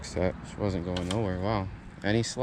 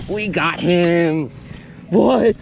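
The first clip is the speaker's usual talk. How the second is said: loud, thrilled shout; excited shout; 4 semitones higher, 4 dB louder, slower than usual. Real, not acted. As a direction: yell it out in triumph. Fish landed, shouting in joy.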